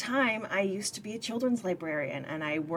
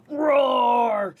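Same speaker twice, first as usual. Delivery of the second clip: dinosaur roar; 4 semitones higher, 7 dB louder than usual. Acted. Daryl roars like T-Rex.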